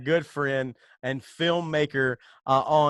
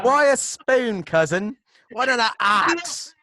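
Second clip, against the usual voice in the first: high, loud hammed-up film quote; mock outraged shout; 9 semitones higher, 7 dB louder than usual. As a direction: shout it in play-acted disbelief.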